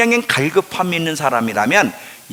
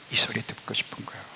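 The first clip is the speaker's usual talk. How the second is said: soft, fast intimate whisper; 5 semitones lower, 15 dB quieter, faster than usual. Shown as a demonstration. Preacher whispering God's longing to the church. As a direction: breathe it as a secret longing. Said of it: "repeated line whispered".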